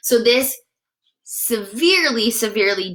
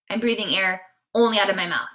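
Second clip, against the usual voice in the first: usual speaking voice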